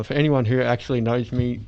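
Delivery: normal talking voice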